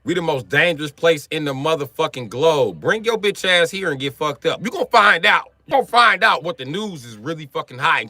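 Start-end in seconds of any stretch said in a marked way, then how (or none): none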